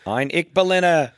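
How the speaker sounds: high, loud JFK Boston voice; JFK impression. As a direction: do a booming JFK speech line. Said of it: "sound like J F K".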